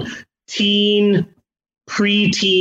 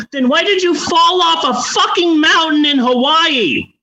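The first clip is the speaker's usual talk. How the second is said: high-pitched incredulous outrage; exasperated rant; 8 semitones higher than usual. Real, not acted. Host challenging the character's useless superpower, exasperated. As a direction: demand an answer, comically exasperated.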